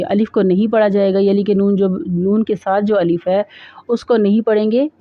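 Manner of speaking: ordinary delivery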